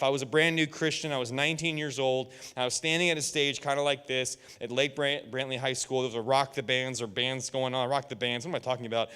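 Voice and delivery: ordinary delivery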